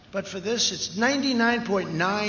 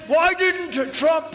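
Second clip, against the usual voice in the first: mocking Biden; 9 semitones higher, slower than usual. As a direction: sneer his question back at him. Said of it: "He said".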